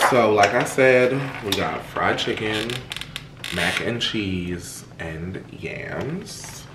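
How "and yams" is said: whispered aside. Real, not acted. Listing the takeout food to viewers, whispering the last item.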